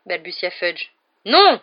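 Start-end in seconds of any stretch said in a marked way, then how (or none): 1.2-1.6 much higher and louder, a fierce growl. A narrator's reading. Hagrid refuses Malfoy's order, angry growl. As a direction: growl it in outrage. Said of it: "gronda Hagrid".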